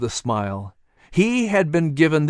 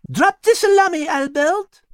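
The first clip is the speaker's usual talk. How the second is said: much higher, sharp scolding; 3 dB louder than usual. Acted. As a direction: scold the selfish cat. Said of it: "Liz scolded".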